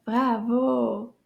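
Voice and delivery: normal talking voice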